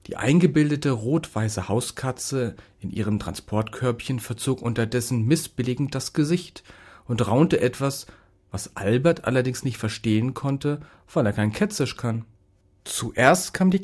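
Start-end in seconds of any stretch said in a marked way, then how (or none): none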